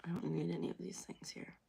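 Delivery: soft unvoiced whisper. Real, not acted. Deleting emails, whispering to herself. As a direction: whisper it to yourself.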